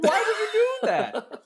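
high, slow, laughing protest; squeaky incredulous question, played for laughs; mock disbelief. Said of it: "I was like".

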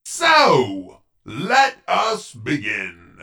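ordinary talk

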